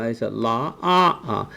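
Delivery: ordinary talk, nothing marked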